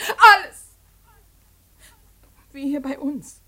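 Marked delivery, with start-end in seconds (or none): 0.1-0.6 loud anguished outcry; desperate cry. Acted. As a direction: fling the word out in despair. Daughter cries out again.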